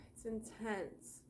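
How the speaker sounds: usual speaking voice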